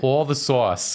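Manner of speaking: mock Long Island drawl on sauce; Long Island accent. Joking about a friend's Long Island accent. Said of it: "if someone has a Long Island accent and you make them say sauce".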